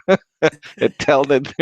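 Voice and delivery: words broken up by laughter; laughing mid-sentence. Real, not acted. Mocking what kettle buyers should know, laughing. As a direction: chuckle around the technical term.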